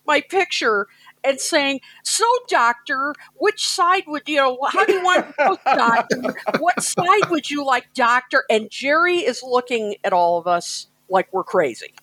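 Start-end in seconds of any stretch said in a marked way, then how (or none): 2.0-8.4 mock teasing. Friends roasting the new doctor.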